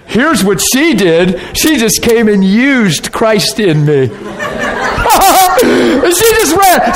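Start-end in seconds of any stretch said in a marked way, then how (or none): 1.5-6.9 laughing through the words, higher and slower; can't hold back the giggle; real laughter breaking up the line. Real, not acted. Laughing while telling story.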